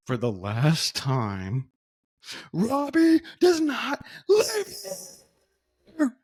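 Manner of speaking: very slow, building to a high tearful wail; broken, exasperated man. Retold or imitated. Prank victim finally broken by years of calls, mock tearful despair. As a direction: drag it out, then crack into a hammed-up whine. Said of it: "we broke him".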